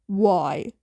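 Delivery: ordinary delivery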